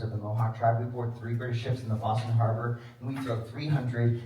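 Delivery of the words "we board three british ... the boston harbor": usual speaking voice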